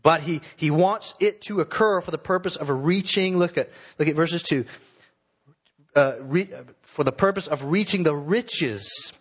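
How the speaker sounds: ordinary talk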